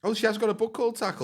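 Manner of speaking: high astonished exclamation; genuinely taken aback, pitch leaps; surprised. Real, not acted. Surprised the title is real.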